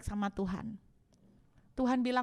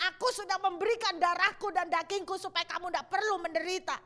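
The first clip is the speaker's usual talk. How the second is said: higher, louder, pleading fervor; impassioned voice of God; 8 semitones higher, 4 dB louder than usual. Acted. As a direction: preach God's words with burning passion. God rebuking through prophet.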